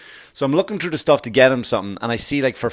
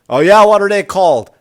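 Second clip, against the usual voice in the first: eager customer; 7 semitones higher, 9 dB louder than usual. Retold or imitated.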